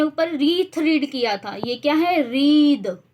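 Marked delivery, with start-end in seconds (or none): 2.3-3.0 रीद said louder, with a crisp voiced द ending; clear demo pronunciation. Shown as a demonstration. Teacher quizzing students, then answering रीद firmly herself. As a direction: stress the final द of रीद. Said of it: "द की sound आ रही है".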